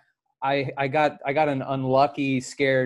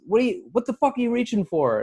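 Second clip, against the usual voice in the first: cop demanding; 8 semitones higher than usual. Retold or imitated. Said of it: "he goes".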